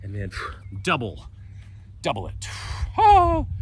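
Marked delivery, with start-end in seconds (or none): 3.0-3.5 sharp high loud startled oh; surprised yelp. Real, not acted. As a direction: jump at something unexpected. Sudden wobble during boat pose.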